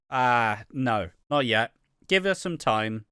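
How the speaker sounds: ordinary talk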